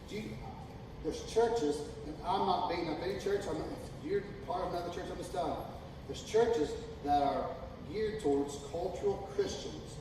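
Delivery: ordinary talk, nothing marked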